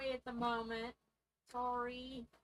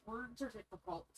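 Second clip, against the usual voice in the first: ordinary delivery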